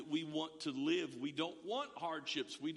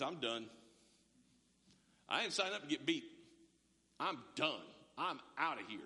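mock quitting apostle; 6 semitones lower than usual. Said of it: "if that conversation had been something more like this".

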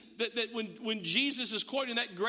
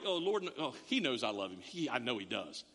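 much lower, self-assured inner voice; complacent believer; 10 semitones lower than usual. Retold or imitated. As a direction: drop low and smug, sure God knows. Churchgoers' smug thought voiced.

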